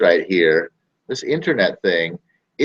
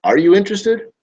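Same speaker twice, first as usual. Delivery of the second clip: high, loud pushy sales call; pushy cold caller; 9 semitones higher, 3 dB louder than usual. Retold or imitated. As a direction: badger them like a cold caller. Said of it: "you have to go to them saying".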